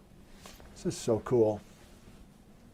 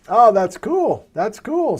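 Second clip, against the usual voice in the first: high, slow, impressed; really impressed, drawn out; excited; 6 semitones higher, 5 dB louder, slower than usual. Real, not acted. Host reacting to guest's story.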